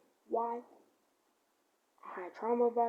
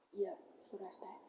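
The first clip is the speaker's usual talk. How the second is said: whispered; 3 semitones lower, 11 dB quieter than usual. Real, not acted.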